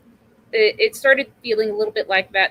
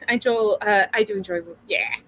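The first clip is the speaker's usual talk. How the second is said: ordinary delivery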